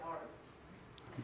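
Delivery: sincere hushed question. Shown as a demonstration. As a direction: ask "how are you?" again, truly meaning it, in a whisper. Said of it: "No, like, legit".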